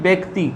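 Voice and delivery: ব্যক্তি said 'bekti', with a closed 'e'; demonstrated pronunciation. Shown as a demonstration. Teacher answering how it is pronounced, ব্যক্তি as 'bekti'. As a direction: say ব্যক্তি as bek-ti, no 'ya' glide. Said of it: "এর উচ্চারণ কি".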